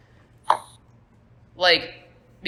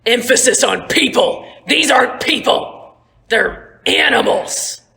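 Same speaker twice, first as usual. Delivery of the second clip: loud, higher contemptuous rant; Merrick furious; 5 semitones higher, 6 dB louder than usual. Acted. Merrick pinned under Stonebreaker, sneering loud fury. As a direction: spit the words out in disgust.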